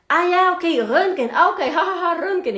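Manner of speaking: high, loud "ah, ya, ok" of realization; sudden understanding. Retold or imitated. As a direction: act the patient getting it. Patient finally understanding, loud. (paciente imitado que de pronto entiende, agudo y fuerte)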